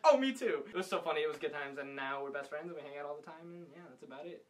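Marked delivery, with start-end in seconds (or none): 0.0-0.6 high, loud mock excitement; eager blurt. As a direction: imitate someone jumping in eagerly. Retold instant eager confession.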